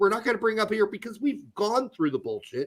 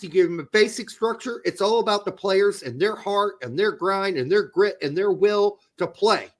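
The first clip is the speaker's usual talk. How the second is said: ordinary talk, nothing marked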